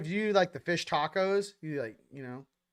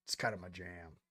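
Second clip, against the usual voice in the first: deep, soft bro voice savoring the words; lazy low "bro" delivery; low mock-cool voice; 10 semitones lower, 15 dB quieter than usual. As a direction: drop low and soft, laid-back. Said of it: "I'm like".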